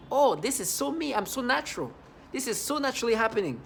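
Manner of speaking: high-pitched, put-on delighted realization; mock enthusiasm. Mocks thoughts they want implanted.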